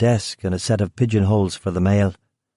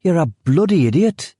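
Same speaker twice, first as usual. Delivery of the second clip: higher, annoyed Brummie insult; exasperated Brummie guard; 8 semitones higher than usual. Acted. Brummie guard calls the foreigner an idiot, exasperated Brummie. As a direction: spit it out in disgust.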